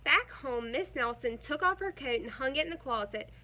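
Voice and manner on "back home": ordinary delivery, nothing marked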